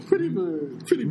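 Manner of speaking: high squawky parrot. Retold or imitated. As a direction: chirp it like a parrot. Repeating parrot line, higher.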